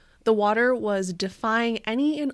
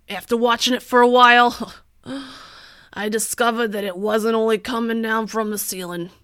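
loud, blustery landlord going on and on; 2 semitones higher, 8 dB louder than usual. Retold or imitated. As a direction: rant like a harried landlord, loud. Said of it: "that's a pretty spot-on landlord voice".

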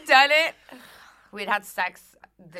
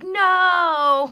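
higher, louder yelled no; shouted no; 12 semitones higher, 13 dB louder than usual. Retold or imitated. Mark protesting his ruined fantasy, loud yelled no. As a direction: yell it in mock dismay. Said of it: "But he was like".

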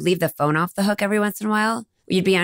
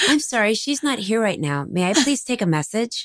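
higher, polite message-taker's voice; formal phone manner; 5 semitones higher than usual. Acted. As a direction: politely put off a caller, put-on formality. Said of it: "It was so formal".

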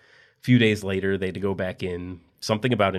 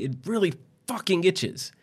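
high, slow whine; whiny complaint; 11 semitones higher than usual. Acted. Husband begging his wife to scratch, high whining. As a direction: whine like a spoiled patient.

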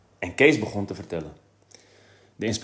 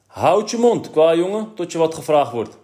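much higher, loud mock scolding; mock stern official; 8 semitones higher, 8 dB louder than usual. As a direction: bark it like a pompous policeman at the desk. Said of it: "zoals op het bureau".

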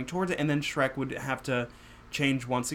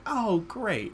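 high, pleased little gasp; delighted gasp; 8 semitones higher than usual. Retold or imitated. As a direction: sound thrilled to see him. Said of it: "I'm like".

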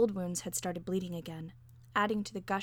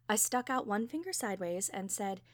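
usual speaking voice

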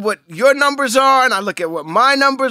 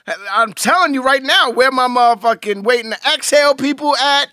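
high, loud hype-man shout-out; mock hype call-out; 5 semitones higher, 3 dB louder than usual. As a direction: call out the crowd like an MC. Hyping up his fan base.

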